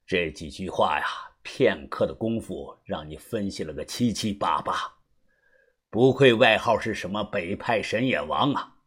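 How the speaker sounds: low, soft character voice with a chuckle before it; quietly impressed older mentor; knowing elder, amused. Acted. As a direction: give grudging praise in a low, unhurried tone. Voices Uncle Xu, amused praise.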